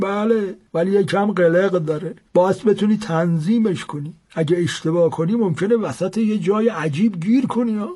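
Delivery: put-on an old man boasting of a magic watch; old seller's voice. A narrator's reading. Old seller warns about the clock.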